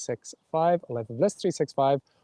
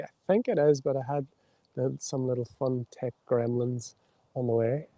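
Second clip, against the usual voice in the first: ordinary delivery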